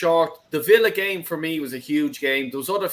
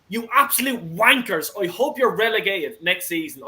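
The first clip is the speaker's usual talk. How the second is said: real, higher-pitched angry rant; wound up; 4 semitones higher than usual. Real, not acted. Arsenal fan rants at Fulham over flying kites, wound up. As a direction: swear at them, pitch climbing in outrage. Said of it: "I don't wanna get wound up".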